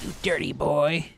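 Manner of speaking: slow, loud put-on old teacher voice; old teacher impression. Quoting the old teacher from a viral video, slow and loud. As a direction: drag out the words like a scolding old man. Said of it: "this guy's like".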